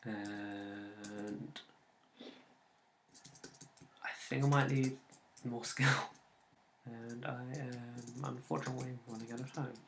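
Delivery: ordinary talk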